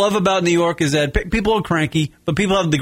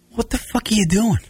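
a hint of an exasperated Long Island driver, pitched higher; annoyed, half-shouted, higher up; annoyed Long Islander; 7 semitones higher than usual. Grumpy Long Islander's motto, as an annoyed bark. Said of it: "like an annoyed".

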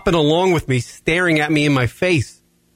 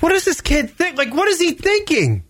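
incredulous; 11 semitones higher than usual. Retold or imitated. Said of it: "I was like".

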